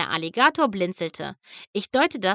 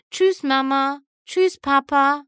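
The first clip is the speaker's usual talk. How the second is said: higher, louder called goodbye; raised voice, high-pitched parting call; 7 semitones higher, 6 dB louder, slower than usual. Acted. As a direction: call a farewell after them as they leave. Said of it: "rief Pinky noch".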